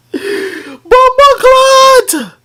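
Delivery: very high, drawn-out exclamation; overexcited high-pitched cry, stretched long; real hyped outburst. Real, not acted. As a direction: squeal it out, totally hyped. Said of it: "I know I'm getting too hyped".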